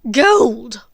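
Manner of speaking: loud scornful huff. Acted. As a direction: scoff at the gold, loud. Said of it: "huffed Bellatrix".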